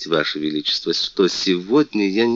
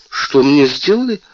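удивлённо переспросив; 9 semitones higher, 6 dB louder than usual. Acted. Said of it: "переспросила принцесса".

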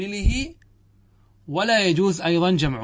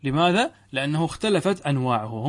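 normal talking voice

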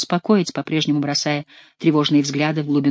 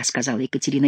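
ordinary delivery

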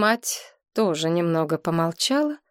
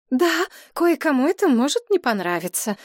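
rushed, anxious, a little higher; quick and agitated; 7 semitones higher than usual. A narrator's reading. Mother anxiously defending her engagement, quick and agitated. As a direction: hurry it out nervously. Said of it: "быстро, взволнованно проговорила мать".

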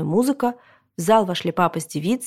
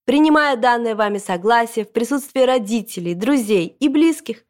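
raised, grand official proclamation; ceremonial registrar; 5 semitones higher than usual. A narrator's reading. Registrar declaring the couple married, ceremonial. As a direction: proclaim it solemnly in a higher voice.

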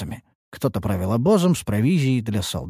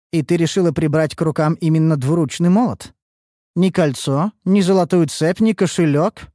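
skeptical smirk; 6 semitones higher, 3 dB louder than usual. Acted. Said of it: "хмыкнула высокая".

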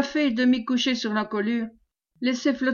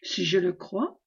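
put-on a scandalised old housewife, low; 4 semitones lower, 4 dB quieter than usual. A narrator's reading. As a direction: lower and softer, hurt indignation. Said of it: "s'écria Fanchon scandalisé".

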